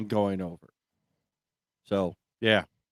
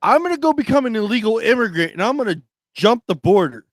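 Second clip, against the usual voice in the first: higher, louder, fast mock enthusiasm; joking excitement; 9 semitones higher, 5 dB louder, faster than usual. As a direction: hype up a joke plan, half laughing. Sarcastic joke about immigrating.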